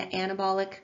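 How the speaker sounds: "anabolic" stressed on the first syllable, AN-a-bol-ic; mispronounced anabolic. Real, not acted. Lecturer reading out reaction types to students, mispronouncing anabolic as AN-a-bolic. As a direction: say "anabolic" as AN-uh-BAWL-ik. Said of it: "I was mispronouncing anabolic".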